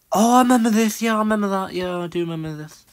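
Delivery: high, loud burst of recognition; excited remembering. Real, not acted. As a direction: exclaim as a memory floods back. Map triggers a sudden memory.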